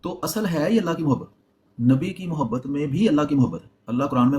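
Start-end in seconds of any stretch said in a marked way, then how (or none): none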